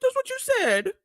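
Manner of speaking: very high, crying whine; mock tearful whining; whiny excuse. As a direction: whimper the excuse like a scolded kid. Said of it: "he's like".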